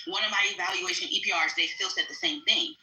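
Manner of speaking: ordinary delivery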